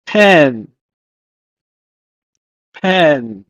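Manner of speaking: "pen" as one isolated word, steeply falling; teacher's careful, standalone "pen"; Hebrew pronunciation demo. Shown as a demonstration. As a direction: model "pen" deliberately, pitch sliding down. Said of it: "In Hebrew, is pronounced as".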